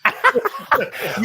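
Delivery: high burst of laughter; high embarrassed burst of laughter. Real, not acted. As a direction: laugh at her own confession. After racy story.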